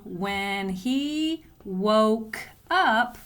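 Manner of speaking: very slow dictation. Shown as a demonstration. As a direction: spell it out in slow words. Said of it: "I have a capital W".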